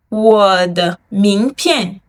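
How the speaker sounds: 我的名片 said slowly, tones clear; slow model. Shown as a demonstration. Teacher reading 我的名片 once for students to repeat. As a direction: read 我的名片 slowly for the class to echo. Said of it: "I read once, you repeat once".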